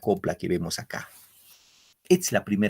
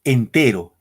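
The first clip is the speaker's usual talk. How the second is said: Entero, marcando fuerte la sílaba "te"; stress on te; 7 dB louder, slower than usual. Shown as a demonstration. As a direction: golpea la "te" de Entero. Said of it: "el acento va en entero". (acento marcado en "te" de Entero)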